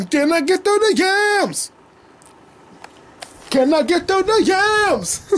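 high, stretched cheering call; excited cheer. Pretend. Cheering on a volleyball player in a high, drawn-out voice. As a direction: hype her up like a fan in the stands. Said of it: "what I say when I see her".

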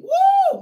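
high whooping cry of praise; ecstatic woo. Real, not acted. Preacher whooping to the congregation, ecstatic high woo. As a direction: let out a joyful woo.

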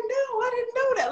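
high, astonished, can't believe it; amazed disbelief. Retold or imitated. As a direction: gasp out the news like it's huge. Surprised at airline job.

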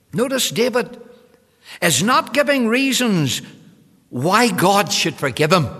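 loud, emphatic pulpit delivery; forceful preaching. Real, not acted. Preacher points congregation to David's lack of excuses, forceful preaching. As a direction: drive each phrase home loudly.

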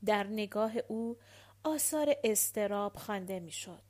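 plain reading voice

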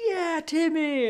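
cheering ad parent. Retold or imitated. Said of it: "They're like".

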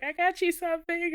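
high playful impression of the friend; sweet gift-giving friend. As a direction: imitate her handing over a present. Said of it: "and she's like".

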